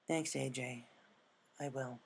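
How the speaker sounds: soft, subdued gratitude; quiet thanks. Acted. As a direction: murmur it gently. Rainbow soft.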